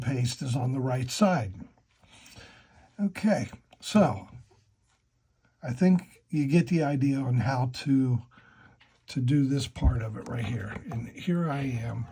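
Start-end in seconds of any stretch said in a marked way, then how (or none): none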